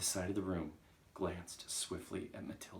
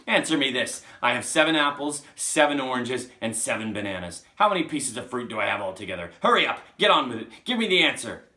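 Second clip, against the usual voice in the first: loud, raised barrage of questions; bullying shout; 5 semitones higher, 15 dB louder than usual. Acted. Trunchbull demanding a sum from Wilfred, bullying shout. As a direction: hound him for an answer at full volume.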